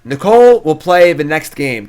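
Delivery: big, loud, drawn-out announcement; mock announcer. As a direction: boom it out like a ring announcer. Mock announcing the next game.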